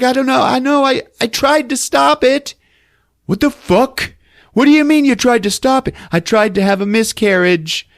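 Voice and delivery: high, loud, frantic back-and-forth; hammed-up screaming match; yelled argument. Mother admits miscarriage attempt to son, both yelling. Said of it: "they're yelling at each other".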